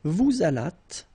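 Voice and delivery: "Vous allâtes" as vou-zalat, slowly; liaison. Shown as a demonstration. Teacher modelling the same link, liaison. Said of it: "la liaison".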